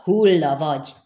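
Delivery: loud, forceful cry; shouted threat. A narrator's reading. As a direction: shout it at the target as a death threat. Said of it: "kiáltotta".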